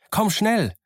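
put-on boy calling out urgently to the castaway. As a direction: urge him to run, the fight has started. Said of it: "rief ich".

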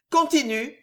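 icy, curt order; cold and detached, no emotion; coldly. Acted. The queen coldly ordering Ruggieri to go on. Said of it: "dit froidement la reine".

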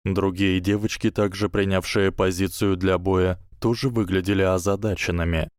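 ordinary delivery, nothing marked

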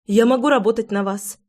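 plain reading voice

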